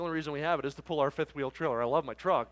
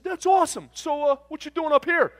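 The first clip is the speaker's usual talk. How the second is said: loud, high, eager trucker; hammed-up enthusiasm; mock delight; 12 semitones higher, 10 dB louder than usual. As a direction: imitate a boisterous new pal.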